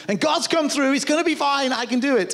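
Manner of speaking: put-on a boy insisting he can do it; eager young David. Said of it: "David said".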